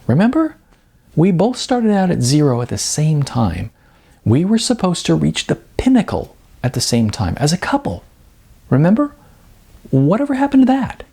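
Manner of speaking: high urgent pleading. Acted. Sarah pleading with Mitchell about their plans, rising high. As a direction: press him, voice climbing high.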